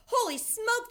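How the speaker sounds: high, loud cry of shock; panicked yell. A narrator's reading. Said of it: "the man was yelling".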